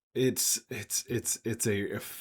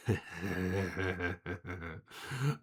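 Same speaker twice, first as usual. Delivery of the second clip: creature's laugh; 5 dB quieter than usual. Acted. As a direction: chuckle slowly from inside a jar. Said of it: "from inside the jar you just hear".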